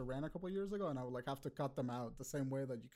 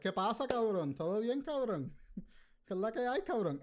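Puerto Rican greeting; 5 semitones higher than usual. Retold or imitated. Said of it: "it's just like".

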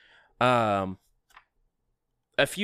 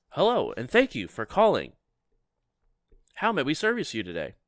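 receptionist voice; 5 semitones higher, 4 dB louder than usual. Acted.

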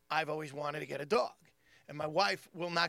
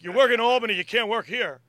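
loud, raised-pitch club boss impression; retold stern ban; 4 semitones higher, 10 dB louder than usual. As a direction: lay down the rule loudly, as the boss. Club owner laying down the rule.

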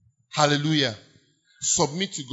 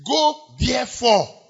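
slow, high, loud command; commanding call; 9 semitones higher, 8 dB louder, slower than usual. Acted. Preacher voicing Jesus sending out disciples, loud and slow. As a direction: sound it out like a commission.

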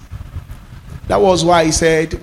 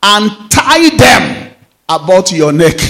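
louder, slow proclaiming of the verse; preacher urging the church to bind the word, louder; proclaimed scripture; 4 semitones higher, 4 dB louder than usual. Acted. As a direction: ring it out like a command. Reads verse over music.

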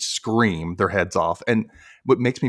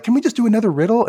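high, fast, louder begging; mock pleading; 13 semitones higher, 5 dB louder, faster than usual. Buzz asks Vink for another chance, pleading.

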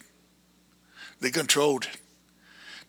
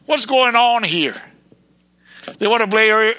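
ordinary talk, nothing marked

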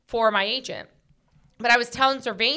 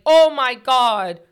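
a drawn-out, louder "oh my God" lament; mock mourning wail; 2 semitones higher, 8 dB louder, much slower than usual. Mocking how people mourn a breakup, drawn-out and loud. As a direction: stretch it out like a hammed-up wail. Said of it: "You're not mourning like".

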